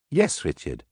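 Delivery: usual speaking voice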